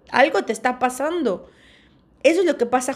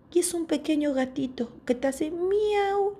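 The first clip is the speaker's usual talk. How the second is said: cute kitten; 6 semitones higher, 4 dB quieter than usual. Retold or imitated.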